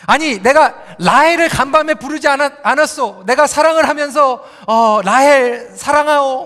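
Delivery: loud, indignant mock outburst; acted angry yelling. Jacob confronts Leah the morning after the wedding, mock angry yelling. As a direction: imitate a husband shouting in disbelief. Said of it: "소리를 지르는 거예요".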